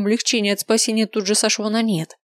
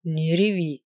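stern low command; 3 semitones lower, slower than usual. A narrator's reading. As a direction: say it firmly, low and strict. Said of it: "он ещё раз строго проговорил".

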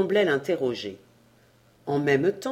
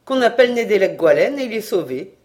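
louder, quick and sure of himself; triumphant; 2 semitones higher, 6 dB louder, faster than usual. A narrator's reading. Trémor proving his point to the villagers, loud and triumphant. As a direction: announce it like a winning card. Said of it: "Trémor triompha".

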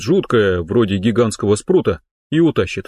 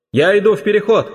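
shouting; 9 semitones higher than usual. Acted. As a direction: yell it up the hose. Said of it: "прокричал Оли".

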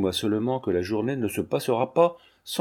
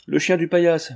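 higher, louder burst of effort; effortful exclamation; 6 semitones higher, 5 dB louder than usual. A narrator's reading. Frightened old servant answers her mistress, with effort. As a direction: force the words out, raised in pitch. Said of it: "répondit Brigitte avec effort".

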